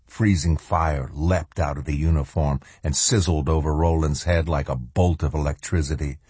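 ordinary delivery

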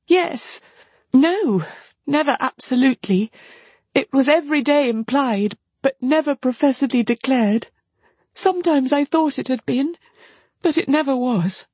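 put-on high, faltering young woman's voice; high voice with pauses between admissions; distressed sister. A narrator's reading. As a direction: confess haltingly, pitched well up. Marianne admits the truth.

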